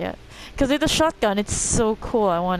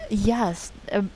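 ordinary delivery

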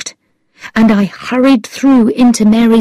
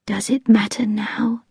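a weak whispered question; dying woman whispers softly; quietly; 8 dB quieter than usual. Acted. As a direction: breathe it out, barely audible. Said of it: "she said quietly".

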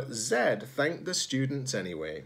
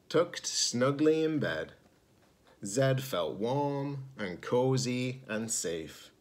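ordinary delivery, nothing marked

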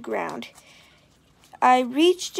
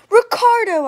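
loud high call across the playground; hollering; 9 semitones higher, 9 dB louder than usual. A narrator's reading. Girl hollering after a boy on the playground, loud. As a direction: holler his name after him. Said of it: "I hollered".

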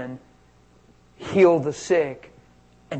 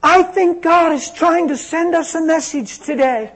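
put-on higher and louder, earnest young woman; young woman's voice; 13 semitones higher, 8 dB louder than usual. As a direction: speak as the girl delivering God's message. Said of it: "Spanish girl says".

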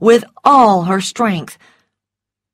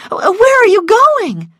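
high, loud, drawn-out cry of dismay; alarmed; 6 dB louder, slower than usual. A narrator's reading. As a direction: demand it in rising panic, much higher. Scarlett alarmed at being left.

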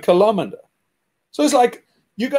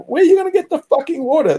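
fast, higher, incredulous demand; exasperated challenge; 7 semitones higher, faster than usual. Retold or imitated. Retelling what he told a dreamer, fast and exasperated. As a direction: fire the question back at them. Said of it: "I said, like".

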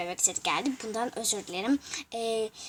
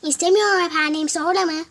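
louder and higher, clear words; 5 semitones higher, 6 dB louder than usual. Shown as a demonstration. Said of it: "Evet, böyle".

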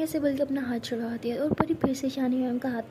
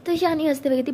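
plain reading voice

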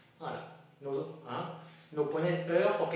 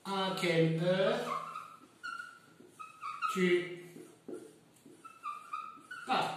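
long pauses between words so students can write; dictation pace; 3 semitones higher than usual. Shown as a demonstration. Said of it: "vous notez".

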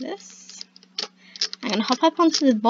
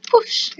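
whispered whoosh; 10 semitones higher, 8 dB louder than usual. Pretend. Door vanishing sound effect.

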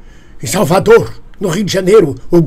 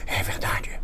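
whisper; 12 dB quieter than usual. Pretend. Conspiratorial aside.

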